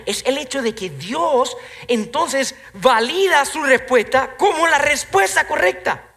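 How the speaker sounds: high, loud preacher's challenge; preaching at full force. Real, not acted. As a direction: press the congregation with the question. (predicando con fuerza, voz alta)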